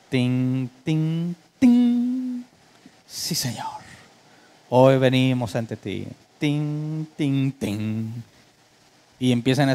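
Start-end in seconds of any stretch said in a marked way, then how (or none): 0.1-2.4 imitando un teclado. Retold or imitated. 3.1-6.1 líder de alabanza burlón. Retold or imitated. Parodia del ambiente de adoración. 6.4-8.1 imitando un teclado. Retold or imitated.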